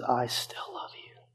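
slow, breathy whisper of love; God's whispered reassurance; whispered words of God. Acted. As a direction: breathe it slowly, tender and close. God's words voiced to listener.

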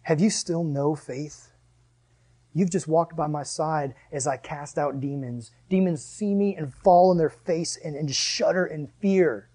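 gently disappointed, slow; slow, soft rebuke. Retold or imitated. Jesus asking frightened disciples, slow soft rebuke. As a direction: ask slowly and quietly. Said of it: "And he says".

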